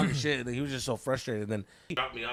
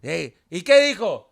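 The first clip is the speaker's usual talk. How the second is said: loud, high mock rant in Spanish; dad talking shit; 5 semitones higher, 10 dB louder than usual. Dad mocking his jobless son to the friends, loud imitated rant. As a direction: imitate a father griping about his son at full voice. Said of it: "my dad's talking shit".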